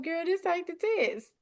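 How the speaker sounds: high-pitched talking to yourself; self-talk pep talk. Acted. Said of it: "just tell yourself".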